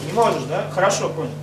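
ordinary delivery

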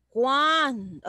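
"Cuándo" stretched out in isolation; emphatic question word. Shown as a demonstration. Teacher highlighting the question word, emphatic "Cuándo". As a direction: draw out "Cuándo" to point it out. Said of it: "porque le está diciendo".

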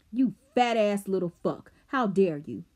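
loud, raging insult; furious outburst. Acted. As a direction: spit the insult out in rage. Rob's furious outburst at Glass.

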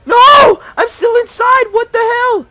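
very high, loud panicked shouting; furious shrieking gamer meltdown; raging screams. Real, not acted. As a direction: scream in outrage at the game. Left behind by NPC, raging.

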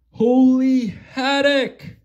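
high, loud startled cry; surprised exclamation. Acted. A character spotting the sword in the stove, startled cry. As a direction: blurt it out in shock.

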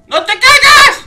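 high, loud, drawn-out warning cry; desperate shout at the screen; real panicked yell. Real, not acted. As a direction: yell at the character not to fall. Shouting at his character on screen.

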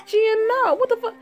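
squeaky high sassy friend; high girly character voice. Acted. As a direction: play it up as a high-voiced diva. Same high character reacting.

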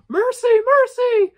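terrified elf screaming for mercy, very high and loud; crying for mercy. Acted. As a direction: beg for your life as the elf. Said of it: "the elf cried".

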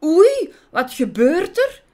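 high, loud yelp of surprise; startled shriek. Acted. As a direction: shriek it in astonishment. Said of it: "gilde Bots verbaasd".

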